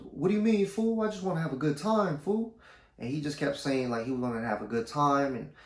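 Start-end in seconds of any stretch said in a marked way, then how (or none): 0.0-2.6 high drugged-up John. Retold or imitated.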